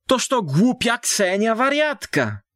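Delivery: high, scornful village gossip calling her "wariatka"; old woman's scornful aside. A narrator's reading. Village woman names Ksenia. (wysoko i pogardliwie: „wariatka”)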